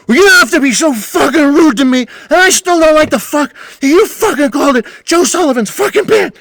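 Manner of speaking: hammed-up furious squeal; shrieking Butch impression. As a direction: ham up an old man's furious shriek. Butch screaming, retold quarrel.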